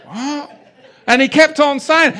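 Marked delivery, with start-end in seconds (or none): 0.1-0.5 high-pitched mock bewilderment; baffled reaction. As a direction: play a crowd taken aback. Imitates the baffled crowd's reaction.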